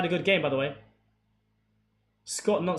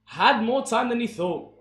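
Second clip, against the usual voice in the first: rising, much higher and louder play-by-play on the attack; live commentary; 7 semitones higher, 10 dB louder than usual. Acted. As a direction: call the chance like a TV commentator.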